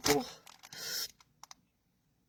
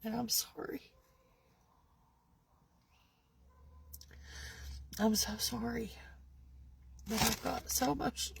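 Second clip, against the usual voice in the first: tearful apology; 3 semitones lower, 8 dB quieter than usual. Real, not acted.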